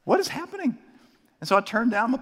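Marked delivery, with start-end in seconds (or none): 0.0-0.8 high, bewildered question; genuinely confused, voice jumping up; what on earth is going on; baffled. Retold or imitated. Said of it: "and I'm like".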